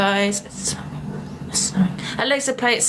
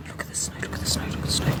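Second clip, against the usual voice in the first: hushed awe; 10 dB quieter, much faster than usual. Real, not acted. Repeats quietly at the window.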